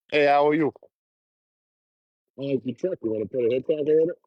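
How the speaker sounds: hitchhiker pitch. Acted. Hitchhiker pitching himself to passing trucks, high and chirpy. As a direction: hawk a ride like a street vendor.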